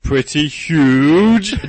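huge drawn-out word, mock professor; hammed-up academic pomp; professor accent. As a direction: stretch it out grandly and loud. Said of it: "'Cause I have an accent".

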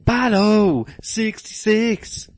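high, drawn-out hero chant; cartoon hero theme. Mock cartoon hero theme shout.

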